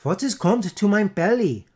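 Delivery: put-on high, loud Dutch-accented roar; roaring boys. A narrator's reading. Boys roaring for their dinner, loud Dutch accent. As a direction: bellow it in hungry outrage. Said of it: "roared all the boys".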